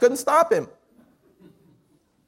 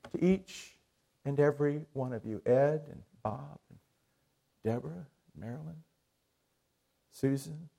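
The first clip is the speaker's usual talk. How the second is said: hushed preaching; 2 semitones lower, 5 dB quieter than usual. Acted. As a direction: bring them in close and hushed. Hushed Christmas sermon close.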